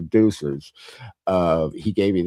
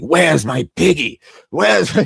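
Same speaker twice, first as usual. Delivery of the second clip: mock angry French dominatrix, higher pitch; lightly put-on French accent, demanding; 8 semitones higher than usual. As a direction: growl it like a bossy French mistress hunting her pet. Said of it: "angry French".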